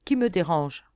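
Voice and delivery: a curt demand at being disturbed; annoyed. A narrator's reading. Young lord roused from reverie by a stranger, annoyed. Startled dreamer looks up abruptly.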